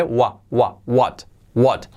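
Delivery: "gua" softened to "wa", building to "what"; short separate "what" with an English W; correct English W. Shown as a demonstration. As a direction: practise "wa, wa, what" with a rounded W. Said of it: "Cuidado, la W".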